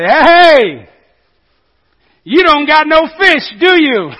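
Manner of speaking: a loud shout across the water; calling out. Acted. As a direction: call out to the boat, teasing. Jesus calls from the shore.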